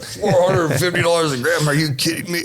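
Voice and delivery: high-pitched disbelief at a price; incredulous. Retold or imitated. Buyer hearing the cocaine price, high-pitched incredulity.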